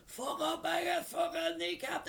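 a high, strained yell from the next bed; mock yell. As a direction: yell it up high like a hurt hoodlum. Kneecapped man yelling in hospital.

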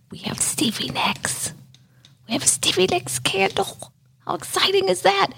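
breathless, hushed whisper of delight; excited whisper. Real, not acted. Showing fans her new candle in an excited whisper. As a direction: whisper it like sharing a precious secret. Gushing over a [name] candle.

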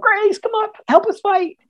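high-pitched imitated call for backup; calling for help. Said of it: "crew members called".

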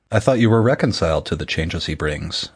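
usual speaking voice

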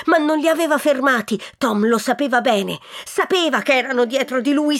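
ordinary delivery

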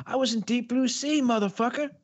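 high shouty swagger impression; Samuel L. Jackson impression. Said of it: "He's like".

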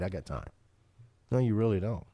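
normal talking voice

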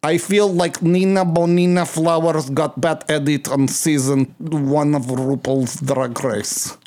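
put-on slow, heavy Russian accent. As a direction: speak it in a thick Russian accent. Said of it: "is that good Russian accent".

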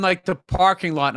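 ordinary talk

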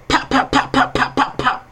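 voiceless popping gunfire sounds; imitated gunshots. Nightclub shooting retold, breathy popping gunshots. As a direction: spit out the shots like pistol fire.

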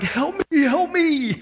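high, drawn-out playful plea; mock crying out. Pastor going around after working with interns, mock plea. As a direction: pretend to cry out for rescue. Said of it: "literally crying out".

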